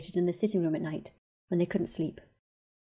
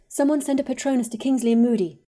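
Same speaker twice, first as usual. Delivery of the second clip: higher, louder sharp order; urgent sharp instruction; 6 semitones higher, 7 dB louder than usual. Acted. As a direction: cut through the room with a crisp command. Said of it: "Hermione said in a sharp voice".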